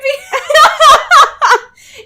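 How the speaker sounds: high, loud and slow, breaking into laughter; laughing squeal. Real, not acted. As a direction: squeal it through a real laugh. Laughing disbelief at co-host.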